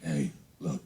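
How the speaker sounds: low-pitched dad impression; mock low dad voice; crazy dad. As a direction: drop down low to imitate your dad. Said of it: "That's how he talks".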